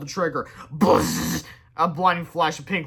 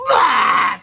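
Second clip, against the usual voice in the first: anguished wail; 6 semitones higher, 11 dB louder than usual. A narrator's reading. Villain wails.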